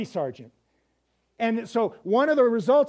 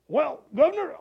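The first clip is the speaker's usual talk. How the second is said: raised, hammy attempt at Cockney; thick Cockney accent; 6 semitones higher than usual. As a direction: put on a clumsy Cockney sergeant's voice. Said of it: "he had this thick Cockney accent".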